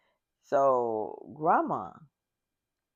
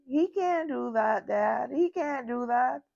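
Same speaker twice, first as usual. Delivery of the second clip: put-on pleading kid; 7 semitones higher than usual.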